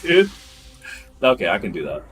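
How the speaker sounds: normal talking voice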